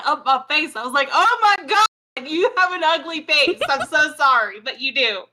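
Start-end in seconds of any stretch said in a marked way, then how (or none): 1.1-5.2 playful high-pitched retelling of shock; mock horror. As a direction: recoil in exaggerated dismay, then apologize. Mock apology to an ugly butterfly.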